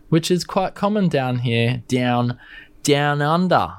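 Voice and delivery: a light, lazy Australian accent on "down under". Shown as a demonstration. Hamming up an Australian accent for viewers. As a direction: drawl the vowels Aussie style, just a touch. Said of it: "just giving you some Australian accent".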